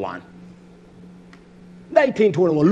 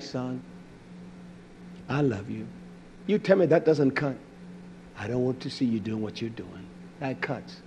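soft, low, gentle rebuke; a mother's soft, cutting words; quiet mother's voice; 8 semitones lower, 12 dB quieter than usual. Retold or imitated. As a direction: say it softly, like a mother who is hurt. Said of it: "when she would say it like that".

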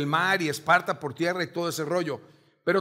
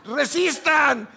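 slow, high, drawn-out battle command; commander's shout; 7 semitones higher, 4 dB louder, much slower than usual. Acted. Spartan leader to his soldiers as they clash, slow commanding shout. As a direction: bellow it to keep the line firm.

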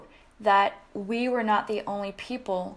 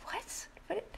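stunned whisper; 11 semitones higher, 15 dB quieter than usual. Retold or imitated. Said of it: "And I'm like".

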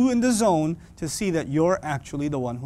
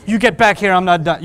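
louder mock parent yell; 7 dB louder than usual. Parent yelling at a leaving child, louder. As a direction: call the kid back angrily. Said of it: "you can't keep saying".

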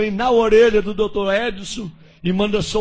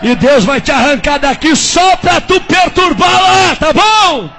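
preaching shout; 7 semitones higher, 9 dB louder, slower than usual. Retold or imitated. Messenger's climax, shouting.

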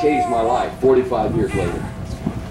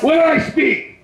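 high, shouted renunciation, much higher; shouted prayer; slower than usual. Real, not acted. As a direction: cast it out at the top of the voice.

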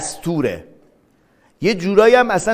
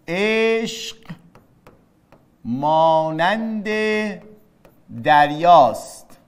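each word set apart by long pauses; slow dictation; much slower than usual. Shown as a demonstration. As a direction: spell the example out slowly. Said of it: "من گفتم چی؟". (آهسته و جداجدا)

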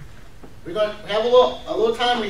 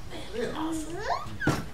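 soft, higher acted marvelling; hushed awe; 4 semitones higher, 14 dB quieter than usual. Leader calling up disciples later, in hushed wonder. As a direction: quietly act out being amazed. Imagined call to disciples, awed.

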